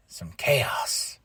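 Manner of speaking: breathy whispered aside. Real, not acted. Whispering to viewers about the chaos.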